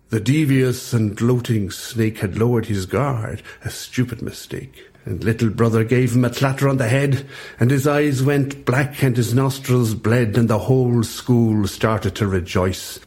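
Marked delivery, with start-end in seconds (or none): none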